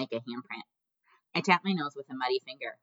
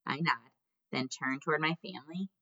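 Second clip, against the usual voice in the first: ordinary delivery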